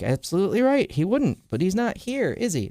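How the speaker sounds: high mocking villain voice, pitched well up; gleeful high-pitched villain taunt; Joker taunt.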